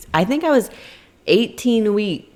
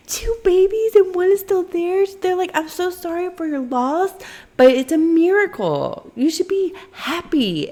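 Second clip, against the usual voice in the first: high, sing-song consoling then cheering; sugary staff voice; 13 semitones higher than usual. Retold or imitated. Staff console then cheer the mother, high and sing-song. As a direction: pat her on the head with your voice.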